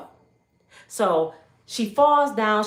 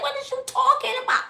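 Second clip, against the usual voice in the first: high, fast disbelief; can't believe what she's hearing; incredulous protest; faster than usual. Retold or imitated. Arrested woman to the bad cop, incredulous and high.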